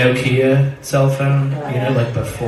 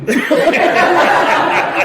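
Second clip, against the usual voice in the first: high breathy laugh; 6 dB louder than usual. Real, not acted. Laughing at friend's offer.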